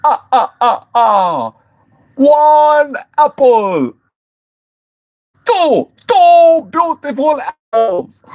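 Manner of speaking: high, slow, theatrical counting voice. Acted. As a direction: stretch each number grandly, with a staccato laugh first. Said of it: "Sound like Count on Sesame Street".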